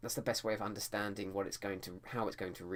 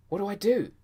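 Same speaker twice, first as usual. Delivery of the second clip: much higher, loud panicked cry; desperate cry; 13 semitones higher, 12 dB louder than usual. Shown as a demonstration. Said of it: "People don't say it blandly".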